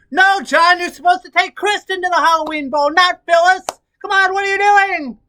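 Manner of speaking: high, very loud outburst at soap characters; yelling at the TV. Acted. Press secretary yelling at her soap mid-briefing, outraged shout.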